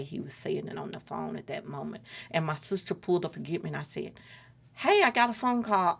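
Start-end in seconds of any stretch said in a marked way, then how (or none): 4.7-6.0 raised voice, pitched higher, calling across. Retold or imitated. Telling her sister she will walk, called out loudly. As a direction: call out to the sister waiting in the car.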